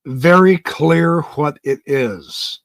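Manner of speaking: slow, drawn-out mock repeat; incredulous echo. Host echoes the clip's claim, incredulous. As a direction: throw the words back in slow disbelief. Echoing the clip in disbelief.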